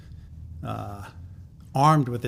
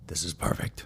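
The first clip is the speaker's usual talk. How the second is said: hushed whisper, lower and softer; 8 semitones lower, 5 dB quieter than usual. Retold or imitated. Manager quietly seizing on the idea, hushed whisper. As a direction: breathe it like a thrilling secret. Miles suddenly approves, grand plan.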